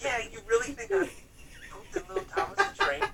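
high sarcastic disbelief, retold; mock indignant. Retelling his own outraged reply in a high squeaky voice.

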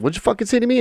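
high, mocking threat from the gang guy; menacing gang guy. Gang guy confronting a scared kid, high sneering threat. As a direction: snarl it like the bully picking a fight.

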